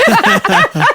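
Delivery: bright high giggle. Real, not acted. Laughs at own word search.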